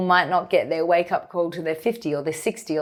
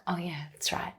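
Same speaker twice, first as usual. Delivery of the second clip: much softer, near-whispered aside to herself; quiet realization; 12 dB quieter than usual. Retold or imitated. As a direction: drop to a hushed, half-whispered self-reminder. Voices her own calming realization.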